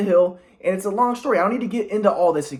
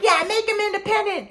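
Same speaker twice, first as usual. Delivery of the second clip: much higher mocking mom; 14 semitones higher than usual. Imitating mom agreeing with grandpa, much higher. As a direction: mimic mom siding with grandpa. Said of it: "my mom's like".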